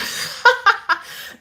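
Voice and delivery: airy high unvoiced laugh; breathy laugh. Real, not acted. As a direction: laugh at the question. Laughing at the question about sleep.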